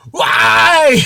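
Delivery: loud, high anguished shout; nerd rage. Retold or imitated. Raging over missing books, a loud high "Why?". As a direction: yell it at the sky. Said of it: "see me nerd rage out".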